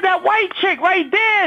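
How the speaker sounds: high, slow, drawn-out ogling; brother's catcall. Retold or imitated. Brother pointing out the woman on TV, high and slow. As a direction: draw it out like a smitten guy. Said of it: "this how he said it".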